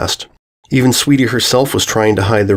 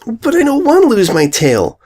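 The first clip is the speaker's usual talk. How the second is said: high, sudden tearful outburst; blurting out; 13 semitones higher than usual. A narrator's reading. Filly clutching the brush, blurting. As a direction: blurt it in protest. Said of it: "Sweetie blurted out".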